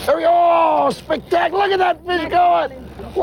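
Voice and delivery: high thrilled shouting; excited shout. Real, not acted. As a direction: cheer the fish on. Marlin running on the line.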